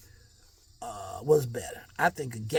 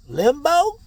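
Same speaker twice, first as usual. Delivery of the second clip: high questioning limbo; 13 semitones higher, 12 dB louder than usual. Shown as a demonstration. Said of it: "Limbo or limp mode".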